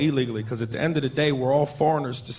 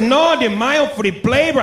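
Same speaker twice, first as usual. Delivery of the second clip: mock Mexican accent; 8 semitones higher, 4 dB louder, slower than usual. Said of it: "He said".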